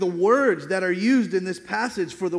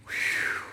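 whew, breathy sigh of relief; relieved exhale; 6 dB quieter than usual. Shown as a demonstration. Preacher showing how you recover after laughing, relieved exhale. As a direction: blow out air to get your breath back. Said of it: "you get your breath back".

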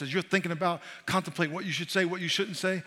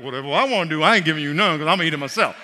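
selfish joking parent; 8 dB louder, faster than usual. Retold or imitated. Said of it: "I'm like".

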